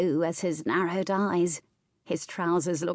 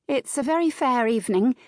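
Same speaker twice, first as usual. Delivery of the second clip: high, louder, forced-cheerful small talk; bright chirp; 6 semitones higher, 5 dB louder than usual. A narrator's reading. Nervous hostess making weather talk with her guest, chirping. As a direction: chirp it, hoping to sound serene. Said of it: "she chirped".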